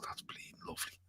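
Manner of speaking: whispered, savouring a stolen bite of ham; sneaky whisper. Retold or imitated. Son sneaking ham from mother's pot, whispering. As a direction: hush it like you're pinching ham from the pot.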